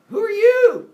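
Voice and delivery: loud, high shout down into the pit; calling out. Acted. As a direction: yell it into the darkness below. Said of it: "He's like".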